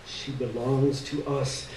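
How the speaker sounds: a soft, slow catch in the throat; voice breaking. A narrator's reading. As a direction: let the voice break, quietly. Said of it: "His voice was breaking".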